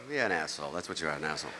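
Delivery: locals brushing off the comic; mock French locals. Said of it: "They go".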